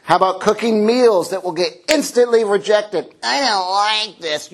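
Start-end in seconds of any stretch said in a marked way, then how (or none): 3.2-4.5 imitating a picky child; a light whiny kid voice refusing dinner. Pastor voicing a picky kid at dinner, lightly whiny.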